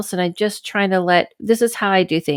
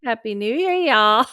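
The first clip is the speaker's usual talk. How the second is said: imitating Southern drawl; 3 semitones higher than usual. Shown as a demonstration. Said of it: "Did we hear that?".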